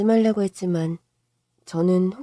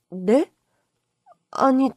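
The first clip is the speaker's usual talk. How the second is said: ordinary talk, nothing marked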